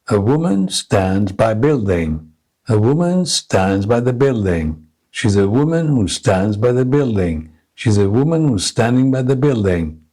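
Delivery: even beats on "woman", "stands" or "standing", "building", small words squeezed between; stress-timed rhythm drill. Shown as a demonstration. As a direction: keep "woman" and "building" as steady beats, little words unstressed. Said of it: "compressing the function words without any stress".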